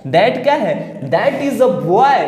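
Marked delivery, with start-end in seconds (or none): 1.1-2.2 very slow, word by word, as if for note-taking; slow classroom dictation read. Shown as a demonstration. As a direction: spell out the sentence slowly for the class. Teacher dictating example sentence.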